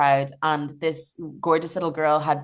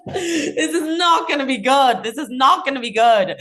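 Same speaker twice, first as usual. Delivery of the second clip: 1 laughing; 8 semitones higher, 5 dB louder than usual. Real, not acted. Laughing at disaster thought.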